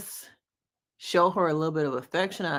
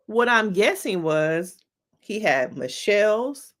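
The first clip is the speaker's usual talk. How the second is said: ordinary talk, nothing marked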